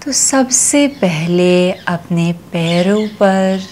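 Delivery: slow, soothing yoga nidra voice with long pauses; guided meditation. Acted. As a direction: stretch the key words and let each phrase settle. Leads yoga nidra relaxation.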